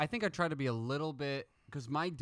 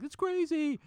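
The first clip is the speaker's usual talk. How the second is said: innocent high voice; slower than usual. Retold or imitated. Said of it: "he's like".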